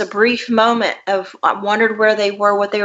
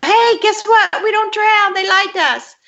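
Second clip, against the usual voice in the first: put-on excited child voice; 12 semitones higher than usual. Girl eager to tell her older siblings the secret, high excited child voice. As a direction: burst out with the news like a thrilled five-year-old. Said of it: "couldn't wait to tell my older siblings".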